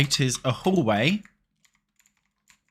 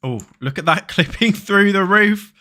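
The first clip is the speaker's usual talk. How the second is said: laughing; 9 semitones higher, 7 dB louder than usual. Real, not acted. Laughing at roof clipping glitch.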